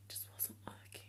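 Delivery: barely audible voiceless whisper; whispered aside. Real, not acted. Vlogger admitting the styling failed, whispered. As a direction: whisper it under your breath. Mouthed aside before repeating it aloud.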